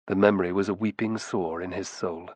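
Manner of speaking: usual speaking voice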